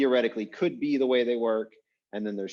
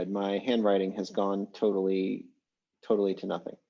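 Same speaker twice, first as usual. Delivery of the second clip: ordinary talk, nothing marked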